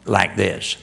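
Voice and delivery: hint of the actress's rasp, half-voiced; mild gravelly impression; gravelly Tallulah voice. Shown as a demonstration. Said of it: "she talked in a gravelly voice".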